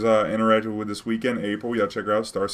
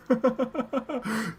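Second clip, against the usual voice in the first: laugh. Real, not acted. Laughs at his own story.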